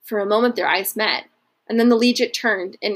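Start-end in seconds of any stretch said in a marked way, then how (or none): none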